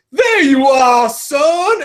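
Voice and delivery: loud, high, gloating villain greeting; spring out and gloat over the cornered prey; menacing villain. Retold or imitated. Jack Baker ambushing the player at the save room, loud gloating. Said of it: "and he goes".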